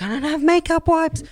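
imitating wife, very high; wife whining. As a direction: whine it as the wife. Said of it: "It's like".

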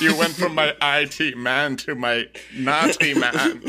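milk the melodrama for laughs; mock melodrama. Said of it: "That was pretty good".

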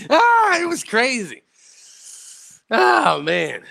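a high burst of laughter into the words; laughing. Real, not acted. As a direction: crack up as you say it. Laughing at passing out.

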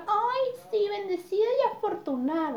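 a light high mock of a smug influencer girl. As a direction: parody a smug Instagram girl, high and simpering. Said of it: "ponen su foto".

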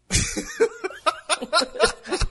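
high shrieking laughter; hysterical laugh. Real, not acted. Cracks up after valendo.

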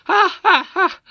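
very high, loud cackle; loud mocking laugh. A narrator's reading. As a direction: laugh him to scorn. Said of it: "laughed the queen louder than before".

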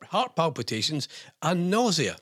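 ordinary talk

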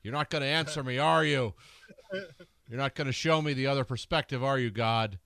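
loud, high-pitched mock indignation; mock challenge to God. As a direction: call God out with comic reproach. Mock confrontation with unanswering God.

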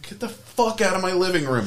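high-pitched mock outrage; hammed-up indignant scolding; imitated angry wife. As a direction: play an outraged wife ordering him out. Wife throws neighbor out, retold.

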